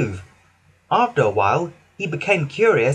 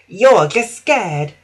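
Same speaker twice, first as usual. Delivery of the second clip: slow, higher mocking jeer; rude taunt; 5 semitones higher, 5 dB louder, much slower than usual. Daisy taunting Toby rudely. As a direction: sneer at him. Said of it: "Daisy said rudely".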